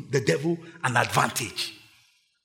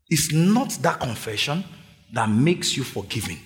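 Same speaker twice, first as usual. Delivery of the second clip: ordinary talk, nothing marked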